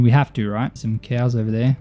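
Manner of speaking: ordinary delivery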